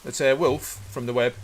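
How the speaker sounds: loud, high call to friends; calling down a hallway. Real, not acted. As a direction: shout ahead to announce yourself.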